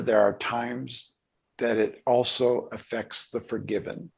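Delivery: ordinary talk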